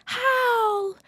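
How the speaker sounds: very high, drawn-out call; high sing-out movie quote; Sophie impression. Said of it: "She says it exactly like that".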